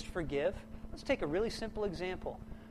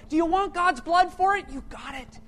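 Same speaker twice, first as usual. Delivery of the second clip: bitter challenge; 8 semitones higher, 11 dB louder than usual. Retold or imitated. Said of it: "they get mad at God".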